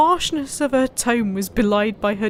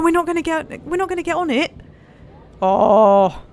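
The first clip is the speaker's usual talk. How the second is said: normal talking voice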